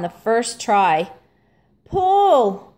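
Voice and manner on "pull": higher, much louder command; ordered pull. A narrator's reading. Arthur orders the skaters to pull the rope, loud. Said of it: "ordered Arthur".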